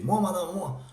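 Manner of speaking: pupil desperate to answer first; eager pupil. Acted. (élève pressé de répondre, plus fort)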